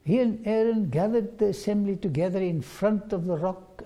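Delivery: ordinary delivery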